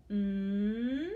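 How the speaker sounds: "mm" as a held hum; isolated sound. Shown as a demonstration. Teacher gives moon's first sound, "mm" held long. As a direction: sustain "mm" on its own. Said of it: "The first sound in moon is".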